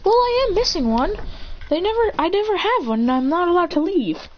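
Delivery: a hint of a gentle mom; mother's voice.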